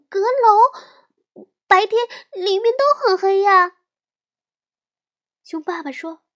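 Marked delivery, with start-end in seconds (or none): 0.1-3.8 high, loud scared little cub; a frightened small girl bear. Acted. Little sister voiced.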